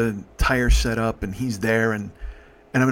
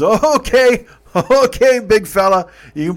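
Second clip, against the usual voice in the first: startled laugh; 10 semitones higher, 11 dB louder than usual. Retold or imitated. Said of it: "He goes".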